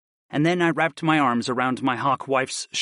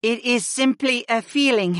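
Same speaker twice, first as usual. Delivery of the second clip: high, gentle female voice; hawk wife voice; 10 semitones higher than usual. A narrator's reading. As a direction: voice the pregnant princess. Freya voiced by narrator.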